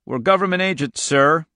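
polite, formal official address; mildly courteous, voice slightly lifted. A narrator's reading. Agent addressing the farmer, courteous. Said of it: "in a low, courteous voice".

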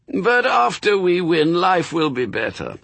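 high, strained angel croak. A narrator's reading. Angel protests to demon about winning, croaking. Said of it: "croaked the angel".

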